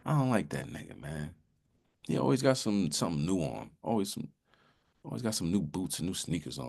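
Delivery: low, soft envious aside; secretly resentful, dropped in pitch and volume; envious grumbling. Acted. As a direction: grumble jealously behind his back, low and quiet. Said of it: "He's just envious".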